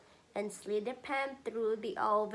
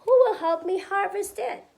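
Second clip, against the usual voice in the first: high, loud pleading question; hen asking loudly; 10 semitones higher, 11 dB louder than usual. Acted. Mother voicing the hen's plea to her son, high and loud. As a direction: call out to the animals for help.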